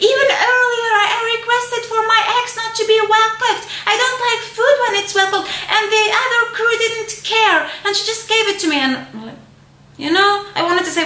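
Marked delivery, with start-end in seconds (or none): 0.1-8.8 high, fast mock complaint with a crying edge; whiny angry passenger. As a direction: imitate her shrill tearful grievance. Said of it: "And she was like".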